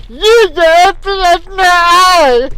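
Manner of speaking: high, loud mocking voice of God; shrill mock deity. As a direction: squeal it out as a scornful God. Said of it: "will probably say".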